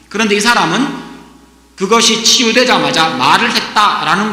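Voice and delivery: loud, emphatic sermon delivery, dropping at some sentence ends; forceful preaching. Acted. Preacher urges congregation to imagine the man's frustration, forceful preaching. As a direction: drive each point home to the congregation.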